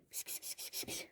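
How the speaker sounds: unvoiced kss-kss to a kitten; whispered kitty call. Real, not acted. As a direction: hiss softly to coax a kitten. Calling kitten.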